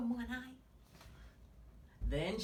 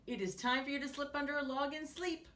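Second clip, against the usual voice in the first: fast, higher rerun of the line; quick reread; 5 semitones higher, faster than usual. Retold or imitated. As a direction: rattle off the quote again brightly. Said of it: "So ladybugs sleep under logs".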